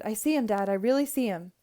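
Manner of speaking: loud, thrilled exclamation; excited. A narrator's reading. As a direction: tell Dad eagerly and loudly. Child thrilled to spot the crow.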